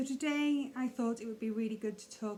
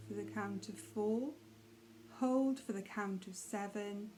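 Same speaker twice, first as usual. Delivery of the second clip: soft, unhurried meditation guide with long pauses; hushed, calm mindfulness voice; guided meditation; 5 dB quieter than usual. Acted. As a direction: gently settle the listener into breathing, leaving space between phrases.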